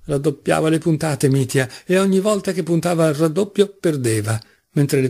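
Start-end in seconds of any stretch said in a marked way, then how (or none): none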